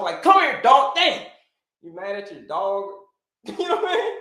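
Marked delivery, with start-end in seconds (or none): 0.2-1.2 high, loud mock yell; mock yell at the dog. Sleep-deprived owner yelling at the dog, mock shout. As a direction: snap at the dog like a groggy parent. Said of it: "You're yelling at the dog".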